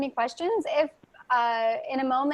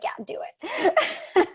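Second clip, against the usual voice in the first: imitating an eager yes, much higher and slow, through laughter; giggly mock go-ahead; laughing quote; 9 semitones higher, much slower than usual. Host voices Angelica urging off-record chat, laughing. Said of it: "So Angelica's like".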